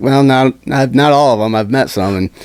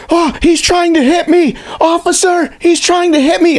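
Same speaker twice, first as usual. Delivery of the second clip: high, slow, hammed-up wail to the officer; over-exaggerated victim. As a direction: overplay being the victim, much higher. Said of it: "completely over-exaggerating".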